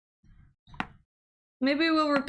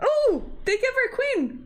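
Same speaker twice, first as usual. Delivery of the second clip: stunned surprise; 11 semitones higher than usual. Real, not acted. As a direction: gasp it out in disbelief. Stunned by the opponent's queen sacrifice.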